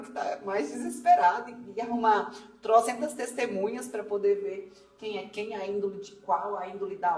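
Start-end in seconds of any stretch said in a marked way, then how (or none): none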